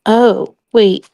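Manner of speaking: ordinary talk, nothing marked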